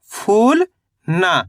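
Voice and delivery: फूल drawn out and rising, a pause, then ना; read in separate parts. Shown as a demonstration. Teacher showing learners how to read it in parts, separated. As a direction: say फूल and ना as two separate pieces. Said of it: "पहले आपको फूल पढ़ना है".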